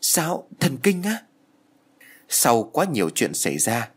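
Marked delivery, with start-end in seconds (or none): 0.0-1.2 stunned, higher, drawn-out question; sửng sốt. A narrator's reading. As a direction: gasp it out slowly in disbelief. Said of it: "Tôi há hốc mồm nói". (sửng sốt, há hốc mồm hỏi lại)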